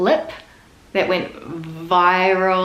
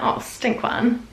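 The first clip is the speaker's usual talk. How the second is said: Oh, stink one; 5 semitones higher, 3 dB quieter, much slower than usual. Retold or imitated. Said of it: "And you're like".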